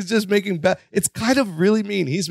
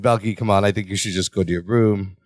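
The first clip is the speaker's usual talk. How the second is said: low soothing Larry; 11 semitones lower than usual. Retold or imitated. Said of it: "He's like".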